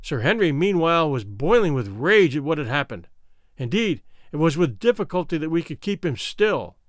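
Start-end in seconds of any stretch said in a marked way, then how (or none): none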